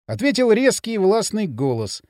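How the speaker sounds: usual speaking voice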